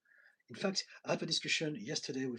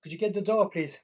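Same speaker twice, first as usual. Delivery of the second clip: calling out; 7 semitones higher, 12 dB louder than usual. Real, not acted. Calling to someone off-stream.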